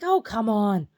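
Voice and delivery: loud, high exasperation; exasperated retort. Retold or imitated. Daughter answers mom's insult in a dream, loud exasperated retort.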